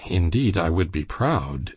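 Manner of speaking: ordinary delivery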